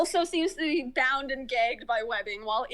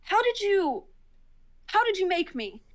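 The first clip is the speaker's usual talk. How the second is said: higher-pitched, lightly voiced creature; creature's startled question; 7 semitones higher than usual. Creature asking the visitor how he was made out, higher-pitched startled question. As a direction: ask it high, falling away at each end. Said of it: "He's like".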